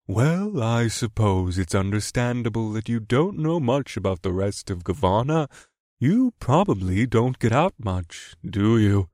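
slow kindly crab, touched with pity; pitying kindness. Acted. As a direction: condescend gently, with pity. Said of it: "benevolent kindness that bordered on pity".

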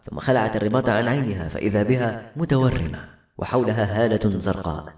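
ordinary delivery

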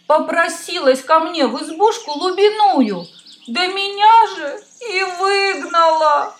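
high, tearful complaining hare; a little hare crying its woes; weeping hare. Acted. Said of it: "Сидит заяц и плачет".

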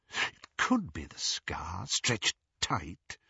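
hushed and breath-held scrutiny; whisper, thinking it through. A narrator's reading. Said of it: "he whispered".

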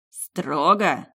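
sharp barked retort. A narrator's reading. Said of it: "гаркнул отец".

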